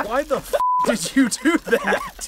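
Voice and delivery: high, slow laughing question; laughing disbelief. Real, not acted. Asking Steve why he made it, high laughing question. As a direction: ask through laughter.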